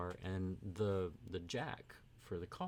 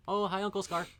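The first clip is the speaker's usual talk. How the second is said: high, loud welcome to the newcomer; bubbly hello to arriving uncle; excited greeting; 10 semitones higher, 6 dB louder than usual. Real, not acted. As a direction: call out a bright hello to family.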